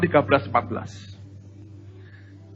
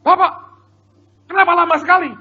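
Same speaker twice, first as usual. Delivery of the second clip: imitated wife's demand, very high and loud; high yelled reproach at a late husband; scolding wife; 7 dB louder, faster than usual. Wife confronting husband after long wait, scolding wife. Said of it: "si istri tanya".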